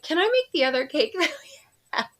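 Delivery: put-on high, fast, giggly kid plea; imitating excited daughter. Daughter begging mom to bake another cake, high fast giggly. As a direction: rush the question like an eager teen, laughing.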